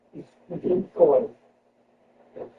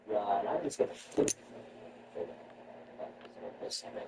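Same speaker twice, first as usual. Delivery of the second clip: ordinary talk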